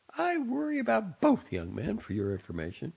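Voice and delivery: high, indignant mother; mother answering her son's teasing. A narrator's reading. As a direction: put him in his place. Mother retorts.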